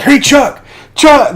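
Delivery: very high, loud hailing; mock calling out. Deacon hailing Chuck on the boat, high urgent yell. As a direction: shout for someone's attention.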